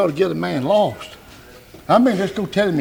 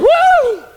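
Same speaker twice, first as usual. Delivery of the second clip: very high, loud, held "woo"; shouted whoop; 6 dB louder than usual. Retold or imitated. Preacher re-enacts his shout at the television, high loud whoop. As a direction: let out a piercing revival whoop. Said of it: "What are you shouting about".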